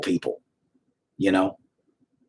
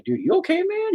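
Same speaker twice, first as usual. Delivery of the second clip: concerned question. Retold or imitated. Asking crying fan if okay.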